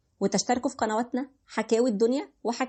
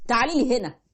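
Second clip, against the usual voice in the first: harsher call. Acted. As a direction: bark it the second time, losing patience. Husband calls again harder.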